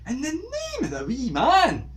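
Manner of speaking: loud, high, slow exclamation of fright; mock outraged teacher yell; teacher's shout. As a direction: bellow it like a startled old teacher. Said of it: "he would shout".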